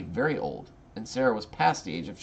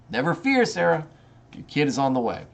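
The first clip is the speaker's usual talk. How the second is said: higher, lightly voiced reassuring God; mock God reassuring; 6 semitones higher, 3 dB louder than usual. God cheerfully reassuring Sarah, a lightly voiced reassurance. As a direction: toss off a cheery divine promise.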